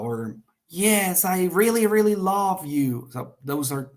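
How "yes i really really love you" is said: REALLY, REALLY lifted high and drawn out; emphatic tone. Shown as a demonstration. Teacher demonstrating tone of voice with a love declaration, emphatic. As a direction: gush it, leaning on both REALLYs. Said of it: "like, tone of voice".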